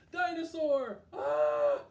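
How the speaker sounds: high, loud pretend scream at a dinosaur; hammed-up gasp of fright for kids; playful storytime scare; mock fright. Scary dinosaur in read-aloud book.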